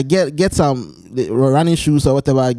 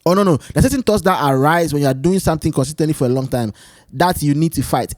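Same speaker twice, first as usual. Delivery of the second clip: usual speaking voice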